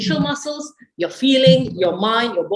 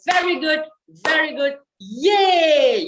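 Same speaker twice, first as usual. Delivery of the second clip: higher, bright cheer very good yay; cheering applause; 6 semitones higher, slower than usual. Shown as a demonstration. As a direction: praise yourself with a cheer. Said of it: "You say".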